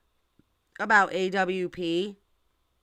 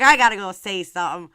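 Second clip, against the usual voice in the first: louder, punched-up retelling; mock-urgent, loud announcement; fired-up retold reply; 9 dB louder than usual. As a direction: repeat it with more force, playfully worked up. Repeats her fired-up reply.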